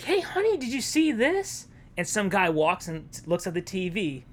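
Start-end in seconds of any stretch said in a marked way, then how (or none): 0.0-1.7 higher voice calling to husband; a hint of a wife calling out; wife calls husband to the news, lightly higher voice; lightly voiced housewife. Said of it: "she's just like".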